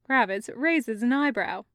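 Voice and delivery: ordinary delivery, nothing marked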